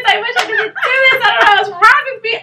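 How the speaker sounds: high, loud mock wail through laughter; hammed-up crying confession, half laughing; retold crying. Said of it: "I'm crying".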